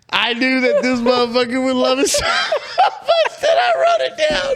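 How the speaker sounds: high, loud laughing through the words; cracking up while talking; laughing hard. Real, not acted. Laughing to the friend about the shrimp story. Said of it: "Look, I'm tearing up".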